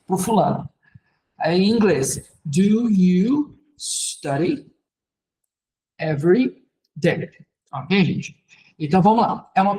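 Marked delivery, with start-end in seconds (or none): 2.4-7.3 very slow, word by word English; slow model sentence. Shown as a demonstration. Teacher building an English question for students, slow model sentence. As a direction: stretch each word with pauses between.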